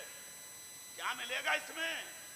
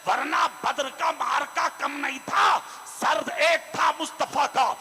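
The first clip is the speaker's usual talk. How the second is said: loud, high sermon at full force; fiery preaching; 3 semitones higher, 9 dB louder than usual. Acted. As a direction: proclaim it over the crowd. Preacher recounting battles.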